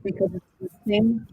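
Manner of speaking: muffled, as if through a hand. Real, not acted. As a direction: speak with a hand over your mouth. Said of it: "Sorry, that was muffled".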